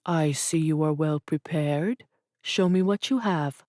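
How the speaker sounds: lightly voiced Arabic-accented father; a hint of an Arabic accent, calm. A narrator's reading. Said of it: "his native Arabic accent".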